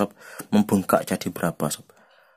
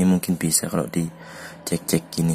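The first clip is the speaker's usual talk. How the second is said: ordinary delivery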